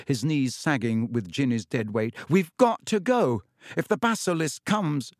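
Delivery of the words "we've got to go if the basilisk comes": put-on urgent boy. A narrator's reading. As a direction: push him to hurry, higher. Harry urges escape.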